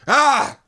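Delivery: short, high, very loud scream; horrified scream. A narrator's reading. Narrator hitting the Taxan, scream of horror. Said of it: "I screamed in sheer horror".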